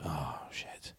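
breathless whispered curse; whispered oh shit. Retold or imitated. Waking to the order confirmation, a whispered curse. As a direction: mutter it under your breath in dismay. Realising he ordered watch drunk.

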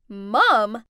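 loud, incredulous exclamation; shouted. A narrator's reading. Rich friends learning the maid is her mother, shouted. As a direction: blurt it out loudly in disbelief. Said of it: "Brent, and Sophia all shouted".

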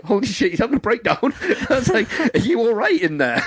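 high-pitched, through laughter; amused mock alarm, pitched high; laughing retelling. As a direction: barely hold back the giggles. Laughs retelling friend's panic in game.

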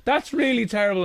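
imitating elderly lady. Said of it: "And he's like".

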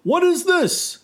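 high, loud startled cry; startled shout. Acted. Father discovers Turtle on his leg, startled shout. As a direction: demand it in shock. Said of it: "shouted the father".

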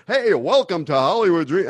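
slick, upbeat TV host voice; game show host. Retold or imitated. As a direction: sell it like a game show intro. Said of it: "you sound like a game show host".

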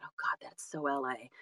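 hushed self-mocking whisper; whispered aside. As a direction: breathe it like a private thought. Quoting own thought about LA.

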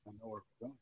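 near-silent whispered realization; awed whisper. Retold or imitated. Fan reliving the moment, whispering in awe. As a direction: breathe it like a secret you just figured out. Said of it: "and you're like".

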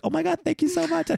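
very high gushing thank you; fake gratitude. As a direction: gush like you love it. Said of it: "Just be like".

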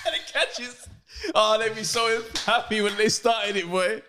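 high giggly laughing talk. Real, not acted. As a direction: laugh through the words. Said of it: "laughing about slow clap".

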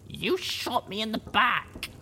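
high, slow, pained heroine voice; groaned. A narrator's reading. Wounded heroine accusing her companion, groaning. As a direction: drag it out, hurting and accusing. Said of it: "I groaned".

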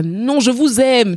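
high, slow, drawn-out declaration; joking stage address. Retold or imitated. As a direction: ham it up to an imagined audience. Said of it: "je commence à parler au public". (déclaration au public pour rire, aiguë et étirée)

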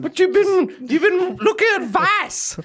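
high, loud nagging mum. Pretend. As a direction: scold him like a fussy mum. [name] plays Stuart's mum.

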